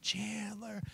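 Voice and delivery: soft, high, stretched-out call; distant calling. Retold or imitated. Owner driving down the street for his dog, distant calling. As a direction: imitate a far-off shout, held back.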